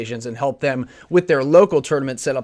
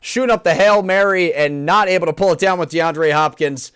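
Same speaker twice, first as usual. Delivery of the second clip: energetic play-by-play commentary; esports caster; 3 semitones higher than usual. Acted. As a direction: call the game like a live caster. Casts Madden gameplay play-by-play.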